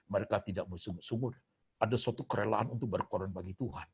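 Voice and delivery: normal talking voice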